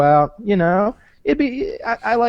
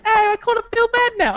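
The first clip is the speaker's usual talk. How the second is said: Kitty feeling guilty. Retold or imitated. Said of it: "She's like".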